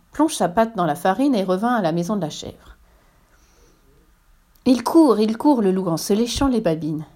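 ordinary delivery

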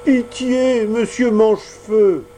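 high begging cry; pleading. Acted. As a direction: plead tearfully for mercy. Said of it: "de sa voix suppliante".

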